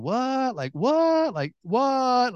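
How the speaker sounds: high-pitched, incredulous "what"; mock bewilderment. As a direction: act out a baffled newcomer. Acts out newcomer bewilderment in China.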